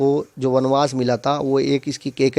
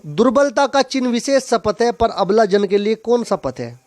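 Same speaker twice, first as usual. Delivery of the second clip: higher-pitched rhythmic poem line; chant-like reading of a couplet; verse recitation; 5 semitones higher than usual. Acted. Recites poem verse before explaining it.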